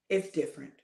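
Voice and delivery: ordinary talk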